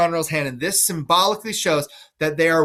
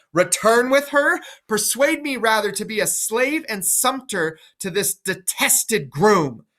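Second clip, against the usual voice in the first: put-on higher, indignant old king's rant; Lear's angry refusal; 5 semitones higher than usual. Lear refusing to go back to Goneril, higher indignant rant. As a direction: refuse with mounting outrage.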